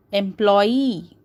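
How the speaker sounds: "employee" with a long stressed ee; final E pulled out. Shown as a demonstration. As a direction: stretch the end of "employee". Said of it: "It is being pulled out".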